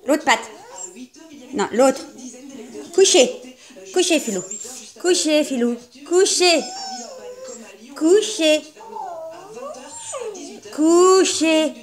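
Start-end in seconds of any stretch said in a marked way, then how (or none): 2.9-11.7 firm command. Real, not acted. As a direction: order the dog down firmly. Repeated commands to dog.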